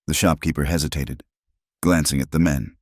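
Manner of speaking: ordinary delivery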